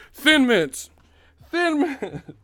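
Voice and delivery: very high, loud repeated "Thin Mints"; frantic code words. Acted. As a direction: squeal the code words in panic.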